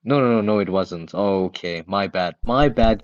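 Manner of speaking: ordinary talk, nothing marked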